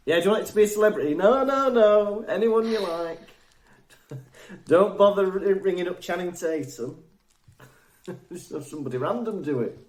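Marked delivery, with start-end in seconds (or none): 0.1-7.0 fast, higher, louder back-and-forth; mock charity call. Pretend Make-A-Wish call about wishes, quick chirpy mock voice. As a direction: rattle it off like a chirpy call handler.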